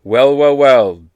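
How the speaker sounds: loud, mock-smug echo of her well well well; cheeky copycat comeback; mocking retort. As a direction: throw her words right back at her. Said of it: "Such an amazing retort".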